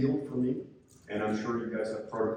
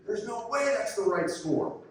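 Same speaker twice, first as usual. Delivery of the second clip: higher, louder mock shout at the official; mock outraged yell; 10 semitones higher than usual. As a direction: play the coach yelling across the gym, half in jest. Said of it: "screaming it out loud for everyone".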